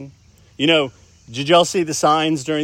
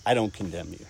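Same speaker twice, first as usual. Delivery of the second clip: gentle; 8 semitones lower, 7 dB quieter, faster than usual. Acted. Jesus quoted speaking to the woman.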